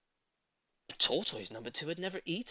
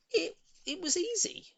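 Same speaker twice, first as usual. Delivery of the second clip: squeaky high delight, much higher; 4 dB louder than usual. A narrator's reading. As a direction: crow with delight at how easy it was. Hoppy's delighted success.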